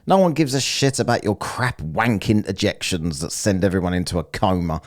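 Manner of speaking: normal talking voice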